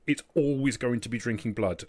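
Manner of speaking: usual speaking voice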